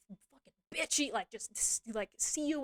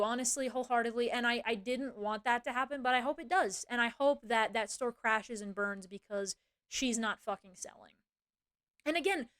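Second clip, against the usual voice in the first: normal talking voice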